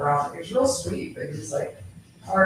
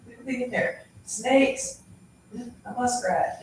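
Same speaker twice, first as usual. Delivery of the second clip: calling from far away; 5 semitones higher than usual. Retold or imitated. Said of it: "calling him in the yard".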